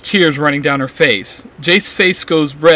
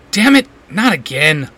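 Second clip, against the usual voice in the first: irritated snap, louder; snapping; 6 dB louder than usual. A narrator's reading. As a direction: curse at the broken bag. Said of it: "Jace snaps".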